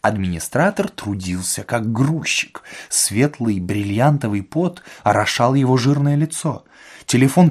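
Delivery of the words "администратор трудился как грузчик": usual speaking voice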